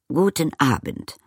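high, coolly formal greeting; frosty, grand politeness. A narrator's reading. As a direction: greet like the lady of a great estate. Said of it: "sagte sie kühl".

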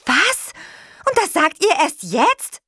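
high, loud outraged question; alarmed disbelief. A narrator's reading. Witch learns her friend is trapped, alarmed disbelief. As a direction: burst out, shocked at the delay.

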